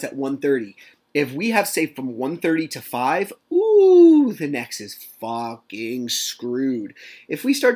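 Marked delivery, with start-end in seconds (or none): 3.5-4.3 a long, high, loud gleeful ooh; anticipating the ex being grilled. Real, not acted. Gleeful exclamation.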